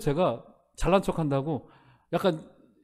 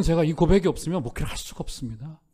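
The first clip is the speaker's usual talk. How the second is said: ordinary talk, nothing marked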